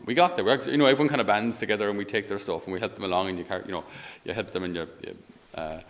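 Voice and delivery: ordinary talk, nothing marked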